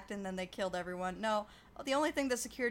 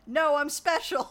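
slow, louder smug claim; mock insistence; 6 semitones higher, 9 dB louder, slower than usual. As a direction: insist like a chosen one, drawn out. Neo acted quote, insistent.

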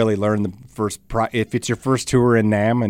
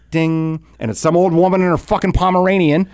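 ordinary talk, nothing marked

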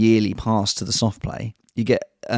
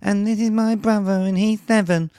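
put-on little girl voice; 11 semitones higher than usual. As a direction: play a chatty five-year-old girl. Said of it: "they were going".

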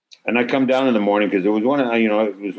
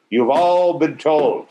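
pointed warning; 7 semitones higher, much slower than usual. Retold or imitated. Director's slow pointed warning.

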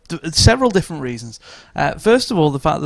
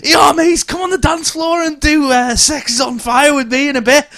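mock lad's shout; 8 semitones higher than usual. Lad shouting invite at club.

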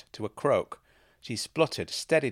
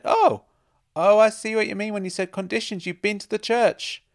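high, loud dawning discovery; excited realisation; 11 semitones higher, 9 dB louder than usual. A narrator's reading. Woman realising he has visited the church, excitedly. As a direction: burst out as it clicks. Sudden realisation after the outburst.

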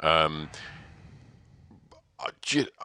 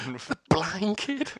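slow high movie voice; 13 semitones higher, much slower than usual. Retold or imitated. Quoting film line.

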